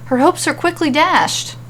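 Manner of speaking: plain reading voice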